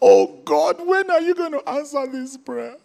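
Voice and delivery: high, whiny begging to God; high, loud mock whine of a begging believer; mock begging prayer. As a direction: parody a desperate whining prayer. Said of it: "They are busy begging God".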